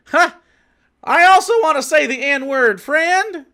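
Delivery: high, loud hammed-up neighbor voice; mock Wisconsin neighbor. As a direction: play a dopey local in a high, bright voice. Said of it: "He goes".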